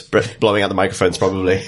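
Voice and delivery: ordinary talk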